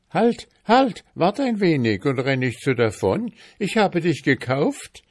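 high, hurried shout after someone; urgent calling out. A narrator's reading. Lad calls after fleeing lizard, urgent high call. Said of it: "rief der Bursche".

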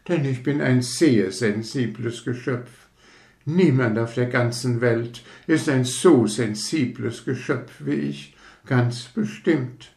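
a lightly voiced conceited rocket, touchy and proud; hint of a fussy aristocrat taking offence; vain rocket. Rocket lectures the fireworks about itself, mildly haughty.

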